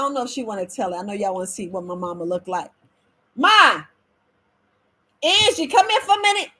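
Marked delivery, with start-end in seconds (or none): 3.4-6.5 higher, louder call across the room. Real, not acted. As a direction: call her over from off camera. Calling mom to camera.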